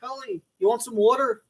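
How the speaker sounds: put-on Philly accent. Commentator repeating the offer of water, Philly accent. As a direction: copy the Philly vowels. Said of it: "That's a Philly accent".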